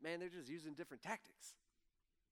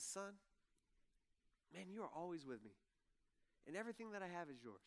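gentle father; 2 semitones lower, 8 dB quieter, faster than usual. Retold or imitated. Father answers resentful son gently.

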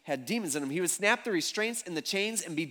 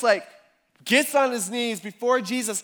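normal talking voice

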